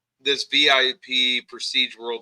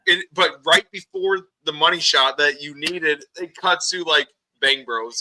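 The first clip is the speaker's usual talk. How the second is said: ordinary talk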